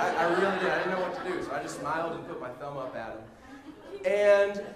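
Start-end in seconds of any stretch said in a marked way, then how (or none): none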